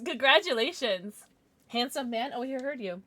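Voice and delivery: ordinary delivery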